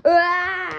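loud, high acted scream. Contestant in the mansion, long high scream.

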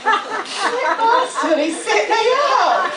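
high, loud laughing indignation; tickled by being set up by the shopkeeper. Real, not acted. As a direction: laugh through mock outrage. Laughing at the prank.

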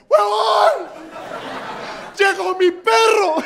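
high, slow ecstatic shouting dog; a dog beside itself with joy at the door; overjoyed dog. Retold or imitated. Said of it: "el perro es como". (perro eufórico gritando agudo)

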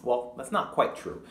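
ordinary delivery

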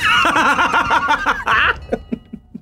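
high, long laugh; helpless high-pitched laughter; bursting out laughing. Real, not acted. Laughing at being killed.

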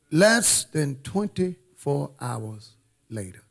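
slow, spaced-out words; deliberate suspense-building delivery; dramatic slow repeat. Real, not acted. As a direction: let each word land with weight.

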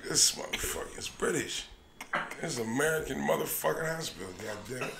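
soft, unvoiced mutter; under-breath muttering. Patient muttering to himself about the doctor, whispered. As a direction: whisper it to yourself. Inner thought, hushed.

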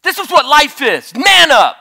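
put-on loud, high shout at the boy; shouting man up. Brothers beating the boy, yelling high and loud. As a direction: yell it down at him, high and loud. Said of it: "so they beat him up".

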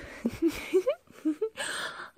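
high, breaking manic giggle; unhinged giggle. Acted. Captor laughing at her own neck-breaking motto, manic giggle. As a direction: let a crazed little laugh spill out.

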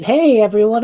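loud cheerful hello; loud greeting. Acted. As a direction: call out to the room as you arrive. Calling out on arrival.

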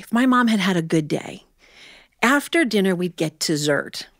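ordinary delivery

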